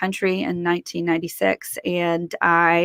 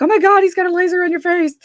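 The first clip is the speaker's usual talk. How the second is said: fast, much higher and louder alarm; 11 semitones higher, 5 dB louder, faster than usual. Retold or imitated. Warning her fiance about a laser, panicked. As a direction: blurt it out in panic.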